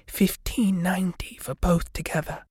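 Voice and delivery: whispered aside to the narrator; mouthed whisper. A narrator's reading. Shop lady mouthing the price to the narrator, whispered. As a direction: breathe the price silently. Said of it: "mouthing to me".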